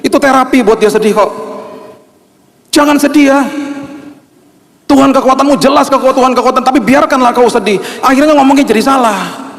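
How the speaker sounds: high, intense preaching voice; impassioned preaching. Acted. Pastor urging mourners' comforters not to talk, impassioned preaching. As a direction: plead with the church.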